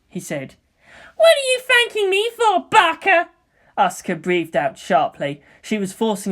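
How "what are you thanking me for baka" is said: put-on high, loud, scornful girl; fiery teen pilot snapping; Asuka's voice. Asuka scoffing at Shinji's thanks, high and loud.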